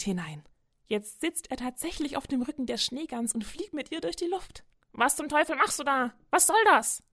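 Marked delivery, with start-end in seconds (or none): 4.9-7.0 loud, high, outraged squawk; furious goose yelling in shock; angry goose. Acted. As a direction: shout at the stowaway on your back. Goose startled by mouse riding.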